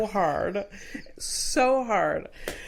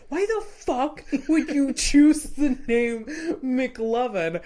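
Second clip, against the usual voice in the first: raised, hammed-up yell through laughter; mock angry yell; 5 semitones higher, 4 dB louder than usual. Friend retells movie scene, mock yelling through laughs. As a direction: play a furious Jonah Hill, half laughing.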